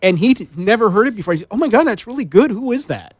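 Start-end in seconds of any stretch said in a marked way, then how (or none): 1.5-3.1 high, louder burst of amazement; mock amazed discovery, pitched up; retold amazement. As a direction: imitate a listener blown away by a discovery. Retelling a new listener's amazed reaction.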